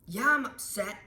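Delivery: put-on loud, sullen yell from an upset kid; angry student. Upset student yells at the teacher, loud and angry. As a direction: shout it back at the teacher.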